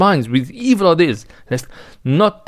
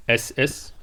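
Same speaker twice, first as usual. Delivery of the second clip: normal talking voice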